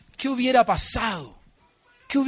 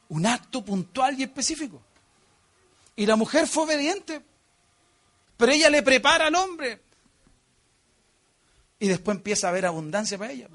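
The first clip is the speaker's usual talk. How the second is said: normal talking voice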